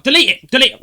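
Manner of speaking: high, loud mock yell; insistent demand. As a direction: imitate an angry woman repeating her order. Retold woman demanding deletion.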